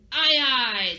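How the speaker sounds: loud, ready sailor's answer; piratey yes. Shown as a demonstration. Teacher showing how to say yes to your captain, piratey. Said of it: "How are you gonna say yes to your captain?".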